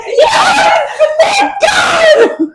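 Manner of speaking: high, laughing shout of relief; overjoyed shout. Retold or imitated. Reader cheering as the boys return, laughing shout. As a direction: cry out thanks through laughter. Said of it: "I'm like".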